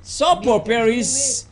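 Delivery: ordinary talk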